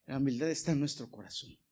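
usual speaking voice

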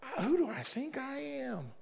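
high, soft, strained cry to God; crying at the altar, who do I think I am; anguished prayer. Retold or imitated. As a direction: plead at the altar, voice breaking high. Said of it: "I'm like, Lord".

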